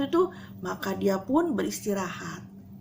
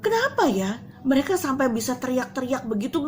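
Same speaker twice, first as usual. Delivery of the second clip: ordinary talk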